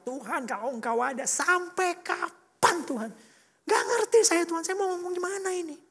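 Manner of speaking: high, strained plea to God; anguished cry out. Retold or imitated. Struggling driver cries to God in his car, anguished yell. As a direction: reenact crying out, climbing high and stretched. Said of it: "saya teriak".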